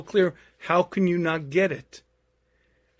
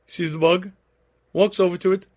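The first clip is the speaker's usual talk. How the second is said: normal talking voice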